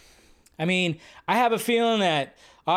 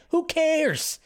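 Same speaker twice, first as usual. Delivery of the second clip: mocking high whine; 12 semitones higher than usual. Mocking repeat, higher.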